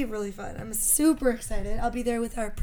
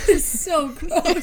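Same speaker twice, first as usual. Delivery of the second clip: laughing; 4 semitones higher, 6 dB louder, much slower than usual. Real, not acted. Laughing at rickets.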